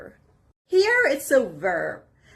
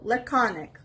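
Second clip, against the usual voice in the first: "laconic" as la-CON-ic, falling; teacher's model "laconic", weight in the middle; stress on second syllable. Shown as a demonstration. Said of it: "The stress is on the second syllable".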